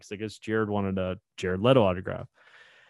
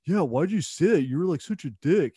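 brother's complaint; 7 semitones higher, 3 dB louder than usual. Retold or imitated. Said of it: "And he was like".

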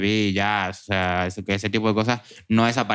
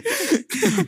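squealing laugh; high laughing burst. Real, not acted. Pirate bit laughter, high far.

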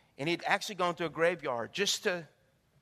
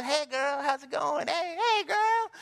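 high, bright sing-song greeting; excited checkout lady greeting; chatty girlfriend greeting; 10 semitones higher, 7 dB louder, slower than usual. Retold or imitated. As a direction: call out to a friend across the checkout. Cashier greeting a friend.

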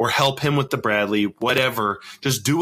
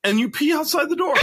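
high mock suggestion. Mock suggestion to desperate soldier.

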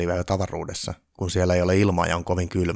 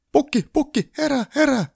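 very high, loud squeal of panic; panicked elf shriek; 4 dB louder than usual. A narrator's reading. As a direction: shriek it like a frantic little elf. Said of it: "tonttu kiljui paniikissa".

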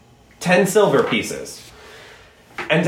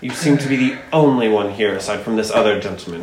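lower barkeep; 9 semitones lower than usual. Acted. GM voices barkeep.

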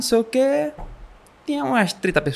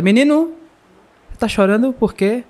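calling out; 6 semitones higher than usual. Retold or imitated. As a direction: call out to the boy in the dark. Calling out to crying boy.

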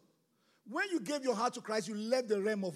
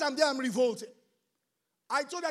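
usual speaking voice

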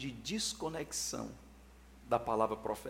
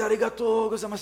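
loud, high-pitched cry of thanks in Japanese; Japanese viewer; 9 semitones higher, 9 dB louder than usual. Acted. Viewer in Japan thanks the preacher, loud exclamation. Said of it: "Quem tiver no Japão".

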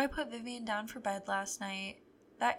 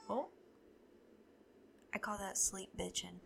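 ordinary talk

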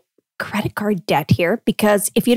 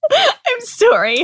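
laughing; 13 semitones higher, slower than usual. Real, not acted. Apologizing through laughter.

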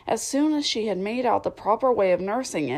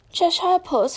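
timid; 10 semitones higher than usual. Acted. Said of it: "she began rather timidly".

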